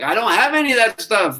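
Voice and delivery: high, plaintive voice of someone with nothing left; imitating a lost, anxious voice; despairing person. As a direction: pitch up like a worried stranger pleading. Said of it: "and they're like".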